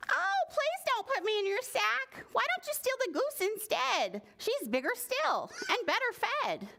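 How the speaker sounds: high, pleading duck voice. Acted. Duck pleading with the two thieves, high voice. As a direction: beg like a fluttery little duck.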